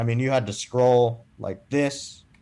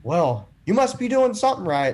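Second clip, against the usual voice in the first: imitating the lady; 8 semitones higher, 3 dB louder than usual. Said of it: "she's like".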